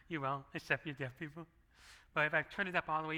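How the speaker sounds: normal talking voice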